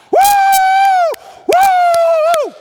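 very high, loud cheering whoop; wild whooping. Acted. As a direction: let loose with piercing celebratory whoops. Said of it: "that was very cathartic".

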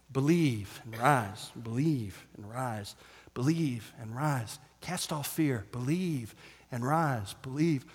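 slow, soft chanted repetition; quiet chant to the congregation; repeated refrain. Real, not acted. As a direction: repeat it like a mantra.

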